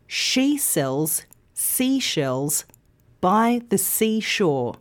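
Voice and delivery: slow, carefully separated tongue twister; slow demonstration. Shown as a demonstration. Teacher models the tricky tongue twister, slow and careful. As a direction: say it slowly, pausing between phrases.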